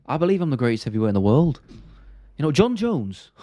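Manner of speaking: lightly put-on heavyweight fighter voice; light mimicry of a British fighter; Tom Aspinall impression. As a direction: imitate a fighter's earnest interview talk. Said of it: "Tom Aspinall impression".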